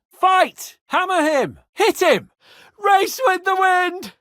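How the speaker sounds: loud, high war cries; battle shouts. Real, not acted. Leading the charge with loud war cries. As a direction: bellow the orders to charge.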